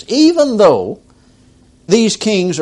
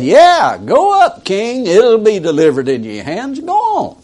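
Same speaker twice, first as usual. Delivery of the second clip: high sarcastic yeah go up king, sliding up; mocking; 3 semitones higher than usual. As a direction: sneer it like a brush-off, much higher. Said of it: "with a mocking voice".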